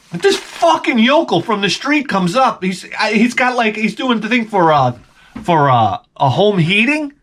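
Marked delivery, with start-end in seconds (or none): 0.1-2.6 high, shouting disbelief; exasperated rant. Real, not acted. Ranting about wife's gullibility.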